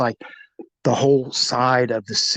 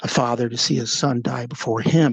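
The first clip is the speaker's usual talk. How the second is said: ordinary talk, nothing marked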